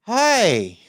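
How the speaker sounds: high, stretched-out, sliding hi; slow-motion greeting. Acted. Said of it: "literally turning in slow motion".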